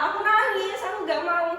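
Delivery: mock upset outburst; retold tearful protest. Retelling tearful refusal to parents.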